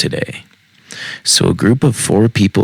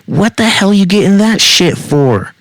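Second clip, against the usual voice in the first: high-pitched angry woman's yell; 13 semitones higher, 4 dB louder than usual. A narrator's reading. Woman slapping the man over his order, yelling. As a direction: yell it indignantly, pitch shooting right up.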